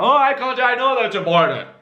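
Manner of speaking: dismissive know-it-all. Retold or imitated. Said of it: "don't shut your brain off".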